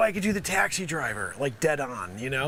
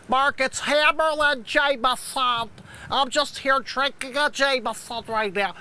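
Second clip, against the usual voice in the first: caricatured boozy anchor, pitched up; Hammerlin Jameson; 4 semitones higher than usual. Retold or imitated. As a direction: do a slurred, put-on newsman voice. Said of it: "you guys know Hammer talk".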